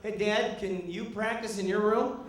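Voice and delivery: put-on polite little boy brush-off; imitated kid. Kids asking dad to leave.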